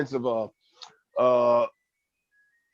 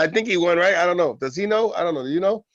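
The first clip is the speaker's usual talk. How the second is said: higher, teasing rhetorical questions; mock confused; 6 semitones higher than usual. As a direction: play dumb and toss the questions out. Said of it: "I said".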